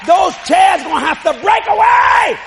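loud, rising revival declaration; full-force preaching. Real, not acted. Preacher to people in wheelchairs, impassioned shouted preaching. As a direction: proclaim it, building to a shout.

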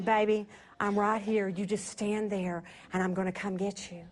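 low, soft tender man's voice; sweet voice. Retold or imitated. Husband's sweet voice soothing his wife, low and soft. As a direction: soothe her gently from the dark. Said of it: "a voice so sweet".

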